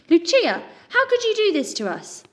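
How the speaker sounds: high, loud anguished cry; hurt and furious; betrayed disbelieving cry; betrayed outcry. A narrator's reading. Pip betrayed by Lucia.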